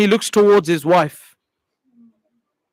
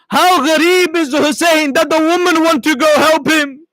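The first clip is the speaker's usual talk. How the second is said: put-on weeping old man; 6 semitones higher than usual. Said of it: "he begins to cry and says".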